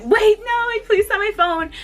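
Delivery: high, loud urgent begging; urgent plea. Retold or imitated. Fan stopping [name] before she signs, urgent. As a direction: stop her in a rush. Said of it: "But I was like".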